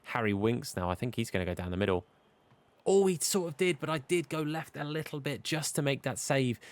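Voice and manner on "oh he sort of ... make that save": excited. Real, not acted. Keeper saves a penalty.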